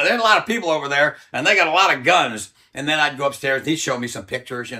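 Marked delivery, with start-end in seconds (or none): 0.0-2.5 hollered warning. Retold or imitated. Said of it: "they'd holler downstairs".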